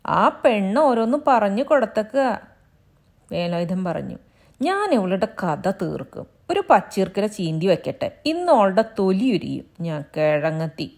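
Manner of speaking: lightly voiced angry husband, a touch of bluster. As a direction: bluster that he will beat her. Husband threatens his wife.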